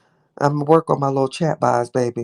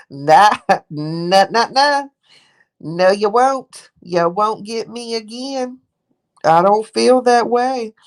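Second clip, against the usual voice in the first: 1 dismissive taunt; 6 semitones higher, slower than usual. Retold or imitated. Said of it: "you can look back and be like".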